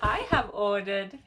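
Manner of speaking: laughing through the words; sheepish giggle while confessing; giggling. Real, not acted. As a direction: giggle it out, a bit embarrassed. Giggling about craving smut.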